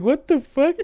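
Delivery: high, startled exclamation; high startled disbelief; incredulous. Retold or imitated. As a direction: throw it out in disbelief. Hears his brother bit his ear.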